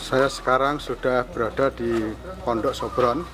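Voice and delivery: ordinary delivery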